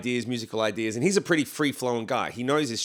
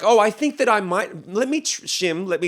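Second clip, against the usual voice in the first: high-pitched, hesitant polite pleading; exaggeratedly meek, halting and higher; mock tentative; 7 semitones higher, 4 dB louder than usual. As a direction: imitate someone timidly asking permission. Mocking polite way drummer doesn't talk.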